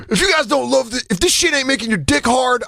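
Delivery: high, fired-up hungover coach; drunk coach. Retold or imitated. Hungover coach firing up the team at morning camp. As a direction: pump them up like a still-drunk coach.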